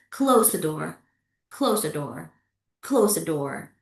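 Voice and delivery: low, final fall to "door"; falling intonation. Shown as a demonstration. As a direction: pitch down on "door". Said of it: "Falling intonation".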